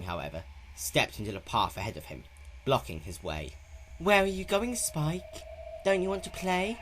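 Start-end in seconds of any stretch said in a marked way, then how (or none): 4.0-6.8 high, playful menace; manic grin. A narrator's reading. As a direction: taunt him like a game. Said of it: "mouth twisting into a manic grin".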